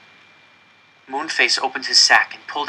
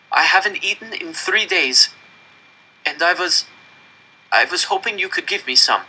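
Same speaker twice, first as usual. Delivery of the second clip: put-on high, pleading boy's voice; desperate plea; 7 semitones higher than usual. A narrator's reading. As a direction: beg for bread from the capo. Starving boy begs the capo.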